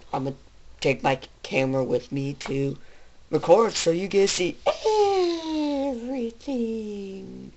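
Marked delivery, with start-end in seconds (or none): 5.9-7.5 soft, slow, lingering delivery; drawn-out everything. Real, not acted. Vlogger promising viewers to record it all, drawn out. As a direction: stretch the word out slowly and softly.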